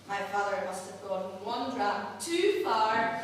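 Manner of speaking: usual speaking voice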